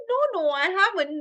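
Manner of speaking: cow voice. Acted. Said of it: "She said".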